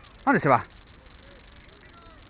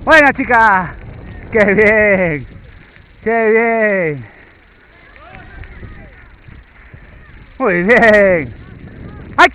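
squeaky praising a dog; 6 semitones higher, slower than usual. Real, not acted. Praising the dog after run.

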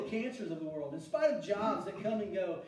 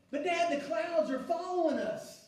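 higher, lightly voiced child full of wonder; a grown man playing an amazed young son; excited little boy; 8 semitones higher, 5 dB louder than usual. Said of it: "he looks up and he goes".

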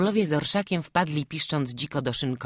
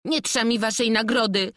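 angry yell; 7 semitones higher, 5 dB louder than usual. Retold or imitated. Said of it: "wrzeszczę ze złością".

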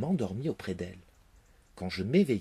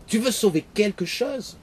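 loud, higher furious disbelief; roaring with anger; 11 semitones higher, 8 dB louder than usual. Acted. The father roars at his son for sparing the rose, loud and furious. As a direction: roar it at the son. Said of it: "en rugissant de colère".

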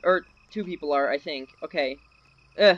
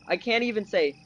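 ordinary delivery

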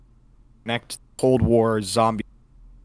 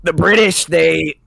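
higher, louder Trump rally voice; Trump impression; 8 semitones higher, 4 dB louder than usual. Mocking British people as Trump on stage, Trump voice. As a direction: ham up a boastful rally speech. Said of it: "That's Trump going up on stage".

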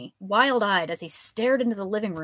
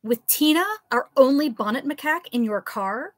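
indignant; 6 semitones higher, 5 dB louder than usual. Acted. Liz scolding the professor.

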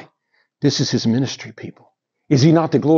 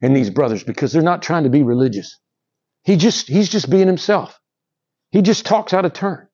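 ordinary talk

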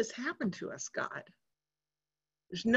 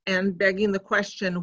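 ordinary talk

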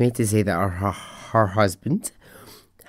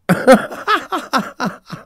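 hearty laugh; 5 dB louder than usual. Real, not acted. Podcaster cracking up at a listener's cheeky email, hearty laugh. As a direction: burst of loud, high laughter.

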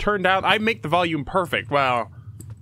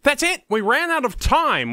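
excited announcement; 8 semitones higher, 3 dB louder than usual. Real, not acted. Announces end of stream time.

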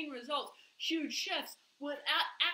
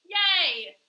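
loud hammed-up cheer; mock cheer; 4 semitones higher, 11 dB louder than usual. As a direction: cheer it falsely. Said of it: "and you're like".

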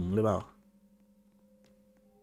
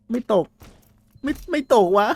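much higher, louder frustrated yell; frustrated shout; 11 semitones higher, 6 dB louder than usual. Real, not acted. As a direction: yell at the monster that won't fall. Monster won't fall into pit. (ตะโกนหงุดหงิด)

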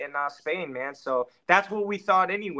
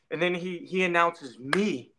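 usual speaking voice

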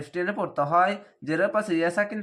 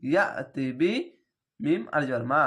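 ordinary talk

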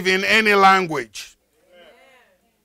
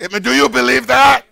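ordinary delivery

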